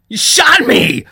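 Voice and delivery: high, loud shriek of outrage; pained yell. A narrator's reading. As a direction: yell it out, shocked and hurt. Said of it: "he yelled".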